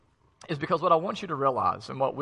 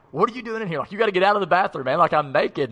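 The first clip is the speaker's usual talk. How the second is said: startled naked brother; 6 semitones higher, 6 dB louder, faster than usual. Retold or imitated. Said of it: "and he's like".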